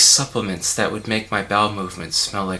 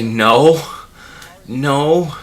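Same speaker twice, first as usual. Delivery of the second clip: loud, irritated denial climbing in pitch; fed-up, emphatic and loud; exasperated retort; 5 semitones higher, 8 dB louder, much slower than usual. Acted. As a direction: shout down an annoying stranger. Snaps back at annoying strangers.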